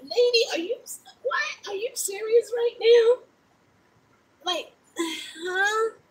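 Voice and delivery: can't believe what she just said; high pitched mock shock at the lady; stunned disbelief. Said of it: "I was like".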